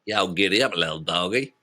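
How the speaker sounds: lightly drawled cowboy "giddy up, little doggy"; a touch of Southern cowboy; mild Texan cowboy voice; Southern American accent. Shown as a demonstration. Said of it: "you say it".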